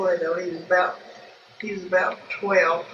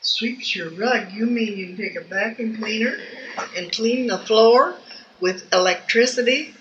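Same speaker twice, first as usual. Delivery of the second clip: incredulous; 3 semitones higher, 4 dB louder than usual. Retold or imitated. Family questions the vacuum salesman's claim, retold disbelief. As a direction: marvel at it, higher and louder toward the end. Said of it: "And we couldn't believe it".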